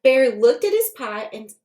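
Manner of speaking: ordinary delivery, nothing marked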